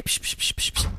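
quick repeated unvoiced shushes; shushing. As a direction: hush someone urgently to listen. Hushing to listen. (hastiges Psst-Zischen)

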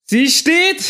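high, loud cheer; Jubelschrei. Real, not acted. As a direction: shout it out in triumph. Jubel nach der Landung. (lauter, hoher Jubelschrei)

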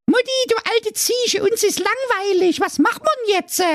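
put-on very high, squeaky little goat kids voice; whiny goat kids calling. A narrator's reading. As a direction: whine up at mum like bored little goats.

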